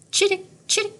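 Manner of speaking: high chirpy sparrow jingle; sparrow chirp. A narrator's reading. Teacher reading to class, chirping like a sparrow. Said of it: "Sparrows make a simple jingle".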